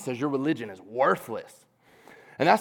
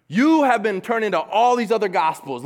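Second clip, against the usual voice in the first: Paul's plea; 5 semitones higher, 6 dB louder than usual. Retold or imitated. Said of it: "basically saying".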